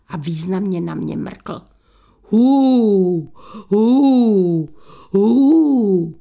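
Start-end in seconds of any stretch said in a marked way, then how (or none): 2.3-6.1 long, drawn-out loud ghost wail; rising and falling spooky hoo; ghostly hooting. A narrator's reading. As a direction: moan like a ghost from a coffin. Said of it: "ozývalo se z rakve".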